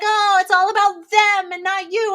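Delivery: high mocking lecture; mock scolding crowd. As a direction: parrot the know-it-alls. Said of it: "everyone's like".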